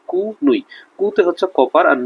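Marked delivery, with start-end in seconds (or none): 0.1-0.6 "কু নুই" in two separate pieces, pitched high; high, spaced-out "কু নুই" for memorizing; syllable split. Shown as a demonstration. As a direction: break it as "কু", then "নুই", with clear u vowels. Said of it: "উচ্চারণ করবো".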